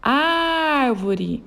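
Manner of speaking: árvore with a long-held AR; calling the word. Shown as a demonstration. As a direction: stretch the first syllable of Árvore. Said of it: "chamando a palavra". (chamando árvore, com o ar alongado)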